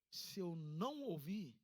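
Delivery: usual speaking voice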